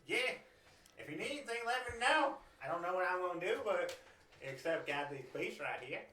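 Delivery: high, loud country voice. Acted. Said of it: "your redneck voice".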